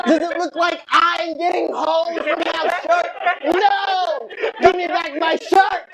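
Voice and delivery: high, outraged yelling; angry rant. Acted. Robbed comic demanding his shirt back, angry rant. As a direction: shout it as a hammed-up demand.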